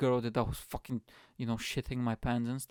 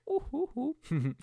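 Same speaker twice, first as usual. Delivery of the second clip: celebratory hoots; 13 semitones higher than usual. Pretend. Celebratory hoots after singing.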